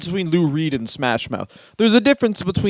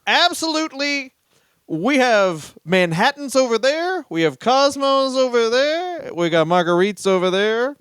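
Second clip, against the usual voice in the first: eager host; 7 semitones higher than usual. Retold or imitated. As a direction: gush it like an overeager party host. Mocking eager amphitheater staff.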